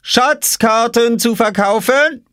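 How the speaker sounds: very high, slow sing-song offer; calling sweetly. A narrator's reading. Oskar offering Jule a map, sly sing-song. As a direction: dangle the offer slyly. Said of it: "rief Oskar".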